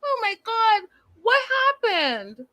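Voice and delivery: very high, squeaky retold astonishment; mock shock. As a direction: act out a stunned reaction way up high. Acting out own shocked reaction.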